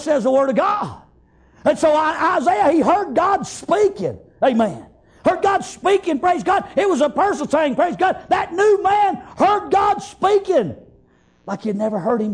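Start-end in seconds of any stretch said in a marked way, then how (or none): 1.6-10.8 rhythmic, driving revival cadence; fervent preaching. Real, not acted. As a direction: preach it with zeal, punching out each phrase. Preaches with force throughout.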